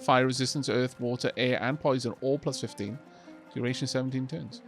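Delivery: ordinary delivery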